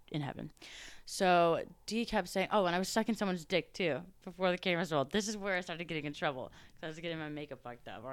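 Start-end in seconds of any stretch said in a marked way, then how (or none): none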